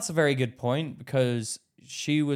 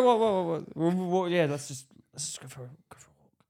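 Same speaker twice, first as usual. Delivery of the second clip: flustered fade to whisper; 2 semitones higher than usual. Retold or imitated. Panicked mumble fading to whisper.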